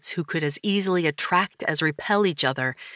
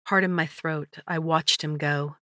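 usual speaking voice

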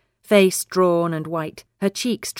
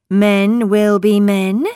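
knowing saying; 2 semitones higher, 6 dB louder, slower than usual. Acted. Elsie's saying quoted.

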